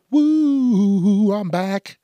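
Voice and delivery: high, loud celebratory comeback; excited whoop. Real, not acted. Host back after a sneeze break, excited whoop. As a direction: whoop it up on returning.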